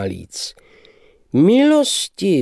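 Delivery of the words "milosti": high, loud gushing "Milosti"; exclaiming. Acted. The young man greeting the lady, with a high, loud gushing cry. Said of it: "vzkřikl mladík".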